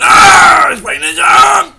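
high, very loud slowed mock yell; teasing a friend with an overblown threat; hammed-up threat shout. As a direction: bellow the threat in playful fury.

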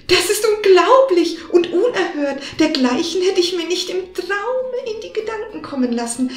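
feigned shock. Acted. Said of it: "Der Kater stellte sich erschrocken und rief".

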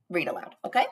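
ordinary delivery

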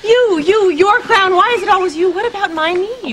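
exasperated, pitch shooting up; angry outburst. Acted. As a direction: snap at him in frustration. Wife bursts out at husband.

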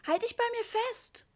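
very high, urgent order; high-pitched alarmed command; mother's shout. A narrator's reading. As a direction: cry it out like an anxious mum in a crowd. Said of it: "schrie Mama".

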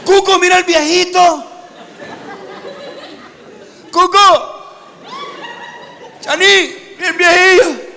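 high, loud, slow calling to a friend; loud excited call. Retold or imitated. Girl calls her friend over to see the old man, high and loud. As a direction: call Cuco over to look, thrilled.

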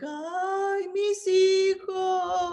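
high, slow, drawn-out weeping wail; La Llorona wail. Shown as a demonstration. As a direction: wail it like a weeping ghost mother. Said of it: "she cries something like this".

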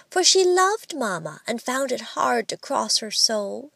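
usual speaking voice

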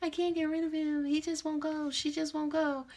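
mock the clients who swear it's their divine flame; mocking the lovesick client. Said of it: "a lot of you is like".

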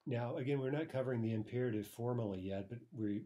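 normal talking voice

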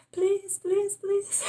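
very high pleading please; frantic begging. Retold or imitated. Late passenger begging the attendant at the door, high frantic plea. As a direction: beg the flight attendant, pitch way up. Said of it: "E eu falei".

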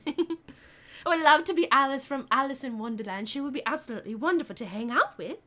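lively mock-British delivery; British accent. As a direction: gush in a put-on British accent. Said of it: "Try your British accent for one minute straight".

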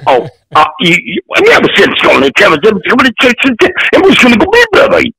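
fast, garbled Creole-Southern accent imitation; strange Southern accent. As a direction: imitate an unintelligible Southern friend, jumping up high. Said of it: "this guy had the strangest accent".

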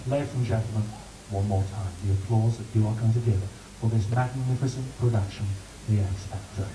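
low, booming showbiz announcer. Shown as a demonstration. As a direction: announce it like the big Saturday night show. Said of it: "So let's try it".

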